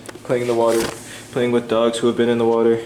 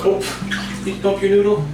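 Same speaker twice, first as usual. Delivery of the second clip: high cooing pet voice; baby talk; 13 semitones higher than usual. Acted. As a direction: coo to the dog sweetly.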